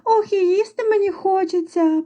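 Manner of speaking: high-pitched hungry mouse; squeaking mouse. A narrator's reading. Second mouse hungry for pies, high squeak. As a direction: pipe it up high like a little mouse. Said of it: "пищить верть".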